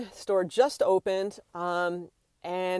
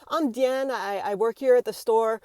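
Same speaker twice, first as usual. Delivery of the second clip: higher-pitched, courteous staff request; polite approach; 4 semitones higher than usual. Shown as a demonstration. Store worker asks a customer to stop filming, polite. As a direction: demonstrate a tactful, firm request. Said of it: "I would approach someone".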